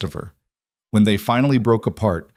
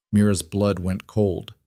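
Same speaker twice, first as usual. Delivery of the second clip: usual speaking voice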